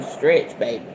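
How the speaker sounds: usual speaking voice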